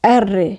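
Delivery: এররে with a vibrating, rolled r; এররে with an Italian trill on the r. Shown as a demonstration. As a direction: roll the r long in এররে. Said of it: "vibration হবে".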